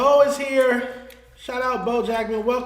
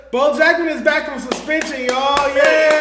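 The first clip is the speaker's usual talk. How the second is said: excited cheer; 5 semitones higher, 8 dB louder than usual. Real, not acted. Cheering a guest's return.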